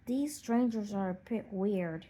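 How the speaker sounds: ordinary delivery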